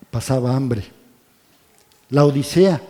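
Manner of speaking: ordinary talk, nothing marked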